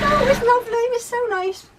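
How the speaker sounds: high, airy gushing quote; imitated carefree daughter-in-law. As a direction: mimic her blissful reassurance in a high voice. Voices carefree daughter-in-law's reply.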